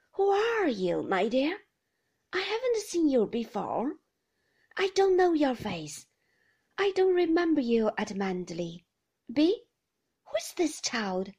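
put-on loud, high, bewildered old woman; an old grandmother who doesn't recognise the guest; confused old lady.

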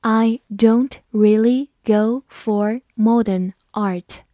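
slow, deliberate reading for the class; careful slowed-down teacher read. Shown as a demonstration. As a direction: read it slowly, word by word. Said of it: "好，慢慢来读".

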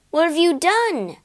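the prince cries out in alarm; startled cry. Acted. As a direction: wake up shocked and cry out. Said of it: "he cried".

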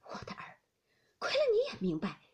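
moved almost to tears; a mother's grateful, tearful cry to the maid. Acted. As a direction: voice catching with a sob. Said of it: "由不得滚下泪来".

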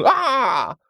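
high yell; high thug yell. Acted. Thug launching himself backwards, high yell. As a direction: yell as you fling yourself back. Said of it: "大喊了一声".

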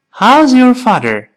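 fast linked "How's your father". Shown as a demonstration. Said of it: "快一些".

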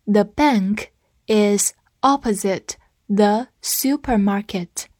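slow, word-by-word model sentence; slow reading. Shown as a demonstration. Teacher leading learners through the sentence, slow reading. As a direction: read it slowly with gaps for learners to follow. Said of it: "跟我慢读一遍".

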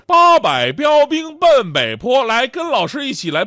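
high, loud and slow, calling the class; loud drill call. Retold or imitated. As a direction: shout the drill over the noise. Teacher drilling noisy kids.

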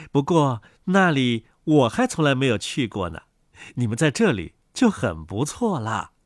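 low, soft mother duck voice; low-voiced storybook duck mother. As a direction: talk low to the ducklings, as their mother.

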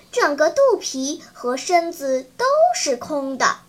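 ordinary delivery, nothing marked

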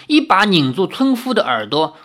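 ordinary delivery

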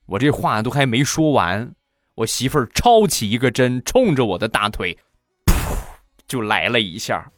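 ordinary talk, nothing marked

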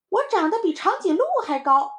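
put-on high, loud excited kid; boasting little child. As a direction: crow about growing tall. Children try to outboast each other. (小孩兴奋地高声夸口)

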